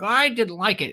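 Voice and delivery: high mock complaint; imagined objectors. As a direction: lightly imitate a grumbling viewer. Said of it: "they'll be like".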